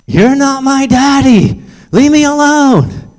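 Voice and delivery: high, loud drawn-out cry of protest; mimicked child shouting for help; little girl's yell. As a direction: yell like a child being dragged off. Acted girl being grabbed by stranger.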